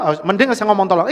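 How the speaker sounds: ordinary talk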